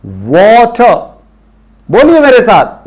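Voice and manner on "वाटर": वाटर stretched very slowly, as "woter"; slow model water. Shown as a demonstration. Teacher modelling वाटर slowly for students. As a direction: draw वाटर out with an o sound. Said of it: "वाटर नहीं".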